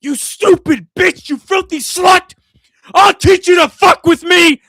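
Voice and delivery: shrieking insults at a victim; Chucky's furious scream. Retold or imitated. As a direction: scream it like a killer doll losing it. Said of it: "the first fucking thing he says".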